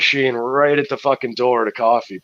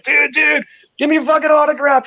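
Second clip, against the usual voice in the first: excited fan; 5 dB louder than usual. Retold or imitated. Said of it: "I'm like".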